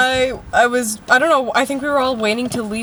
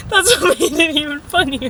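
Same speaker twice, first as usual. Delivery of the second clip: higher, giggly delivery; talking through laughter; 4 semitones higher than usual. Real, not acted. Telling friend why it was funny, laughing. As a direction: get it out while still laughing.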